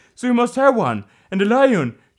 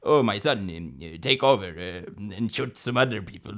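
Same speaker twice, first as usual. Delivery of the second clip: low, soft, halting voice; mafia boss; 8 semitones lower, 6 dB quieter than usual. Retold or imitated. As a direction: hand over quietly as the boss. Godfather hands over in sequels.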